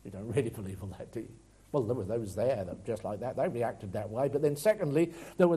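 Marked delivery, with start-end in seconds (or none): 0.0-1.3 low, fast, softer sneering mutter; scoffing doubter. Retold or imitated. Preacher voicing a sceptical listener, quick low scoff. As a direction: wave the faith away. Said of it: "maybe you're like".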